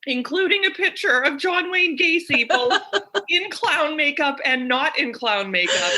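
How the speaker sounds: high, giggly reveal with laughter breaking in; laughing disbelief. Real, not acted. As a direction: barely hold the laugh while telling. Amused by absurd listing photos.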